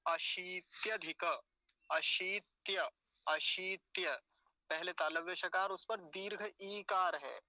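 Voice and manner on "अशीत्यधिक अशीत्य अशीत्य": अशीत्यधिक slow with a long ī; अशीत्यधिक in careful pieces; model pronunciation. Shown as a demonstration. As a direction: stretch the "shee" in अशीत्यधिक. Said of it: "इसको कैसे उच्चारण करेंगे".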